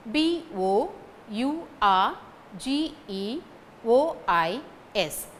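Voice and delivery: slow spelling, pauses between letter groups. Shown as a demonstration. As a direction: spell it slowly for learners to follow. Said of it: "I say it again".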